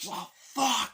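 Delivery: high, loud curse; alarmed mock yell. Bad guy caught in gunfire, alarmed yell. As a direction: yelp the curse as bullets fly.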